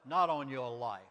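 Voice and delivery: usual speaking voice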